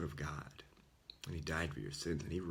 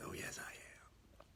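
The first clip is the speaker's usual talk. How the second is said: slow, hushed, whispered answer as God; calm, whispered reassurance; soft whispered reply; 10 dB quieter, slower than usual. Retold or imitated. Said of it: "And God says".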